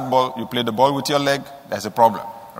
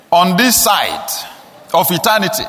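ordinary talk

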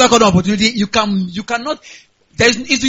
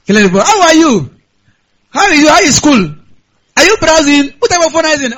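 eager uncle; 5 semitones higher, 4 dB louder than usual. Pretend. Uncle voiced on the phone.

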